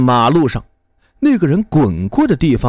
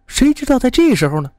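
ordinary delivery, nothing marked

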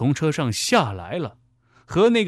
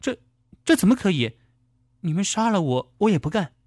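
high, stammering objection; indignant protest; 6 semitones higher, faster than usual. A narrator's reading. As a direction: object in a raised pitch, refusing outright. Driver protests against her captor's order.

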